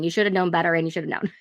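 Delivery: ordinary delivery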